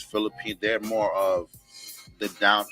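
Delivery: ordinary talk, nothing marked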